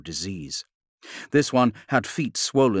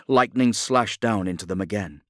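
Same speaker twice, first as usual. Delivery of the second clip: ordinary delivery, nothing marked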